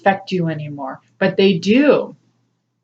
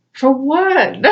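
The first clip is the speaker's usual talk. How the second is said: high, baffled question; puzzled; 6 semitones higher than usual. Retold or imitated. Asking the sad past life, high and baffled. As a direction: toss it back with puzzled disbelief. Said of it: "And I'm like".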